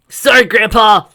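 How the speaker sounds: high, loud and slow; loud high apology; shouted apology. Acted. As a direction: call out over the brawl.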